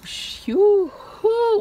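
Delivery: long, drawn-out whooshing fart noise; slow swoosh rising loud and high; imitated big fart. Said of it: "it's a big fart".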